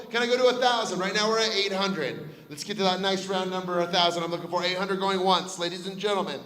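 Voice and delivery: loud auction call building to shouts; auctioneer. Real, not acted. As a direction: drive the bidding home, loud. Auction calling, rising bids.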